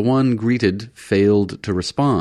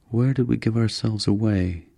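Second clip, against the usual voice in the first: musing; 3 semitones higher than usual. A narrator's reading. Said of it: "Bolin mused".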